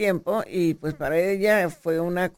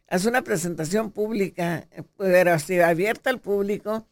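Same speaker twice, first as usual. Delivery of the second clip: ordinary talk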